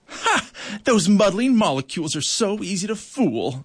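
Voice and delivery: sharp high "Ha!" then a sneering boast; gloating snort. A narrator's reading. Crickwing gloating over the trapped ants, scornful and sneering. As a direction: crow over the fooled ants with a scornful laugh. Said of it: "snorted Crickwing".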